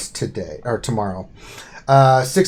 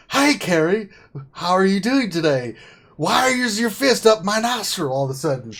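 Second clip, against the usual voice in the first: higher-pitched playful questioning; mock bewildered politeness; 5 semitones higher than usual. Host asking Carrie about her sudden fist, mock bewildered. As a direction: act out a puzzled appeal. Acted exchange with aggressive Carrie.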